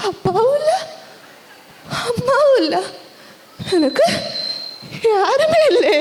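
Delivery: high-pitched, wounded lament; acted heartbroken child; forlorn lament. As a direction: lament being all alone, pitched high. Abandoned child laments having nobody.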